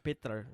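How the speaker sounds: "Peter" with a clumsily rolled R; attempted rolled R. Shown as a demonstration. Co-host failing to copy the name, attempting "Peter" with rolled R. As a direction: try rolling the R in "Peter". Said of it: "when you roll your R".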